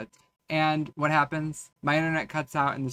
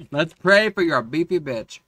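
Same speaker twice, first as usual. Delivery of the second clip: squealing laugh; 3 semitones higher, 10 dB louder than usual. Real, not acted. Laugh then loud joke.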